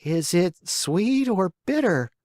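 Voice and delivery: put-on high, slow whining puppet boy. A narrator's reading. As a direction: whine it out like a sulky child. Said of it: "asked in a whining voice".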